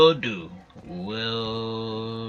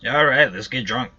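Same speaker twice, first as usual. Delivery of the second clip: loud, eager call to party; excited cheer; 3 semitones higher, 10 dB louder than usual. Real, not acted. Streamer cheers with game drink.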